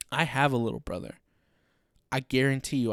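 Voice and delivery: normal talking voice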